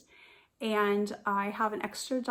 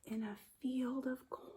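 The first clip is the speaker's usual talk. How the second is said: hushed whisper for a tiny mouse; 2 semitones higher, 7 dB quieter than usual. Acted. Teacher whispering about the newborn mouse. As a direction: breathe it out softly.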